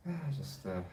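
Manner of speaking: usual speaking voice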